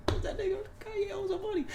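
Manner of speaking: high laughing voice breaking through; words riding a laugh. Real, not acted. Laughing while explaining [name] owes him money.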